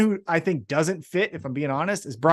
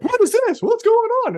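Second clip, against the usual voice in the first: higher, mock-baffled fan asking what's happening; mock confusion; 4 dB louder than usual. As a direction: play a baffled viewer, pitched well up. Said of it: "you're like".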